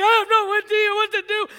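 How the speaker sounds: loud, high-pitched panicked outburst; acted freak-out. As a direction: act out losing control at the wheel. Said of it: "I'm freaking out".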